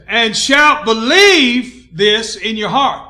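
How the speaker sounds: loud, emphatic pulpit delivery; full-force preaching. Real, not acted. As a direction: proclaim it to the whole church.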